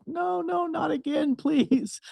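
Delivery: high, desperate begging voice; pleading restaurant owner. Retold or imitated. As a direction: beg him not to come again. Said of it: "it was almost like".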